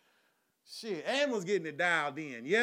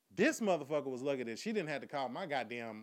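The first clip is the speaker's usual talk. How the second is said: usual speaking voice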